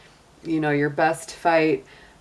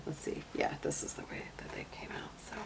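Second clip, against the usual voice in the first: soft whisper to herself; 16 dB quieter, faster than usual. Real, not acted. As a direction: whisper it under your breath, fast. Muttering to herself while laying out cards.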